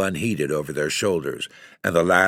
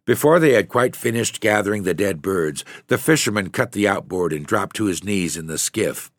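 ordinary delivery, nothing marked

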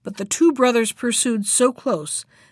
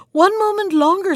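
high, louder plea for time; urgent plea; 10 semitones higher, 5 dB louder than usual. A narrator's reading. Wife answering her shouting husband, urgent plea. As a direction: plead urgently in a raised pitch.

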